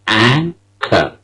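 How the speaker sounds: "Anchor" with a long stressed AN and short kor; "Anchor" with the final R dropped. Shown as a demonstration. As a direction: hit the first syllable of "Anchor". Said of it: "it is stressed".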